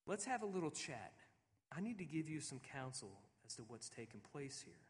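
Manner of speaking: soft, tender voice of God; gentle invitation. Acted. God invites Job to sit down and talk, soft and tender. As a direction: coax him over quietly like a friend. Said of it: "it's as if God is saying".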